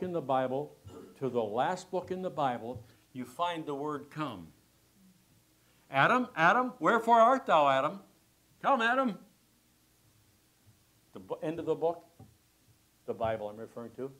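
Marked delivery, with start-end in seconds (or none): 5.9-9.2 high, loud calling voice of God. Acted. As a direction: call out across the garden for Adam. God calling Adam in Eden.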